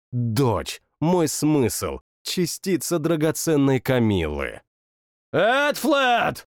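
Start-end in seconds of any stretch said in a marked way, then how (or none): 5.3-6.5 higher calling shout; shouted name. A narrator's reading. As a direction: call out across the yard. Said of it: "крикнул я". (громкий зов)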